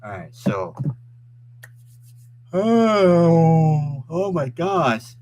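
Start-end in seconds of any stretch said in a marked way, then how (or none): 2.5-5.1 long loud yawn through the words. Real, not acted. Streamer tired and hungry, real drawn-out yawn. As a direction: stretch the words out in a big yawn. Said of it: "I apologize for my yawning".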